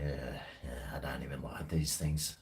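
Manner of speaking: retold whispered thought. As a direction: breathe it out like a private thought. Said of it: "I was like".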